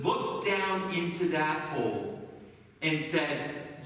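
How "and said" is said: normal talking voice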